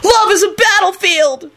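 high, drawn-out triumphant cry; triumphant holler. A narrator's reading. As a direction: holler it like a slogan, stretched and high. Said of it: "Kenny hollered back triumphantly".

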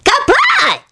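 very high squeaky shriek; creature shriek. A narrator's reading. Bespectacled creature shouting its name, high shriek. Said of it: "shrieked a taller".

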